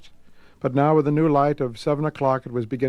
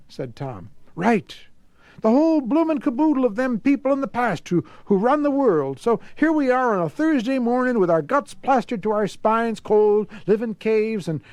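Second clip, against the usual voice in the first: put-on much higher, rough old man voice; Grigsby bitter; 6 semitones higher than usual. A narrator's reading. Grigsby explains the hatred to Tom, high and bitter. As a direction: rail against the old world.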